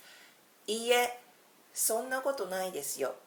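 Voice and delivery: modelling いいえ、そんなことないですよ, clear and quick; teacher reads the humble phrase to learners, quick and modest; Japanese demo phrase. Shown as a demonstration. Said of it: "you can use this phrase".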